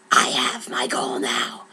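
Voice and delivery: menacing low whisper; Brom's voice. Acted. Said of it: "Brom said".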